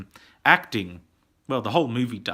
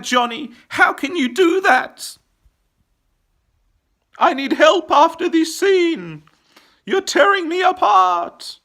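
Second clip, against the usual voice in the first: high, loud mock-distraught wailing; hammed-up anguished outcry; 13 semitones higher, 7 dB louder than usual. Reviewer protests Johnny's suicide scene, hammed-up anguished shouting. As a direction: cry out in melodramatic despair, building louder.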